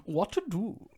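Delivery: high, slow, mock-concerned quote; hammed-up villain musing aloud; haughty film villain. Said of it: "I also love when he does".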